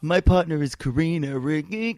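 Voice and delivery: dopey imitation trailing into nonsense syllables; The Situation. Comic mimicking a contestant naming his partner, dopey drone. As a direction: keep making sound after the words end. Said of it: "my version of him".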